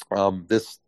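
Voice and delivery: ordinary talk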